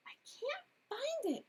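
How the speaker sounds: high, worried little voice; anxious cat fumbling for the lost pan. A narrator's reading. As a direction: say it fretting, searching in vain. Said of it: "she said anxiously".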